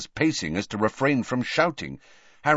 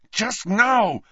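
high, tight, angry reply; barely restrained yell; 12 semitones higher, 4 dB louder than usual. Acted. Harry answering Dumbledore, anger barely held in. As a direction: hold back a yell with great effort. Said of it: "refraining from yelling with enormous difficulty".

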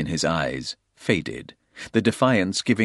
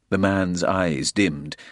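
ordinary delivery, nothing marked

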